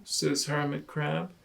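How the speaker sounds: plain reading voice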